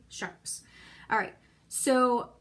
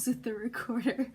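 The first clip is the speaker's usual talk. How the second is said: laughing through the words; 2 semitones higher, 4 dB louder than usual. Real, not acted. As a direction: giggle at the squeak. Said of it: "laughs at own squeak".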